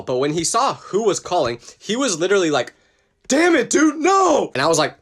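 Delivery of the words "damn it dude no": high, loud groan of dismay; frustrated shout. Retold or imitated. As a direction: yell it like the worst call ever. Friend sees girlfriend calling.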